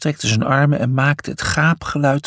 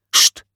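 sharp hiss for silence; hissing shh; 3 dB louder than usual. A narrator's reading. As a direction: hiss to hush them. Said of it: "siste hij".

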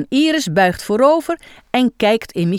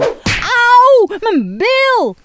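much higher, louder ouch; mock pained yelp; 6 dB louder, much slower than usual. Michiel hit on the bottom, hammed-up high yelp. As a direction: yelp at the smack. Smacked with tennis racket.

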